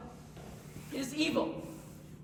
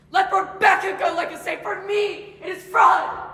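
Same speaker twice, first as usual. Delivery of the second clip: louder and higher, the words spat out; bitter shout; 6 semitones higher, 10 dB louder than usual. Acted. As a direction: rage against his own falseness. Proctor rejects sainthood, shouting.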